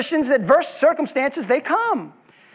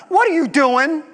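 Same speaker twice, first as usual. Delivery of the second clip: mock defiance; 3 semitones higher than usual. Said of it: "how dare you come up to God Almighty".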